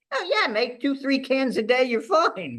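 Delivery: hammed-up Aussie shrugging it off; mock carefree Australian accent; Australian mate. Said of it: "he was Australian".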